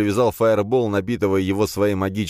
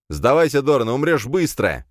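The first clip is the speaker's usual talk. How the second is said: shout; 7 semitones higher, 4 dB louder than usual. Acted. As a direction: bellow the ultimatum. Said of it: "крикнул я". (громкий грозный крик)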